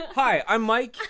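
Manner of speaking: high, loud host hello; bright intro greeting. Acted. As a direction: greet the audience with gusto. Third take of the intro.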